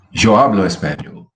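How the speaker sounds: hablo punched on its first syllable; stress on HA. Shown as a demonstration. Teacher showing present tense in a sentence, first-syllable stress on hablo. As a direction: lean on the HA of hablo. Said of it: "how the accent makes the difference".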